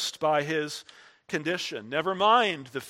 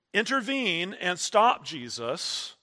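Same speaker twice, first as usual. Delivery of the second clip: ordinary talk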